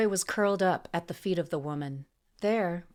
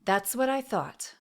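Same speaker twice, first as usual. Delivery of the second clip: higher, smug sneer; 6 semitones higher than usual. A narrator's reading. Assassin after winning the standoff, sneering. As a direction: sneer it with a smile. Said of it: "Emery sneered as she smiled".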